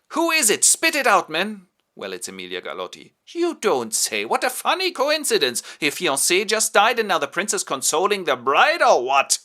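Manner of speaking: high, loud sarcastic outrage; jealous fury. Acted. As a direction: demand answers, biting. Jealous countess confronts the courtier.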